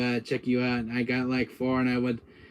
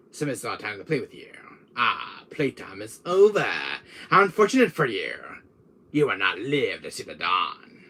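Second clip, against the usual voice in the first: Wesker; 2 semitones lower than usual. Acted. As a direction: savour every word as a taunting villain. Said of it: "I even do a pretty good Wesker voice".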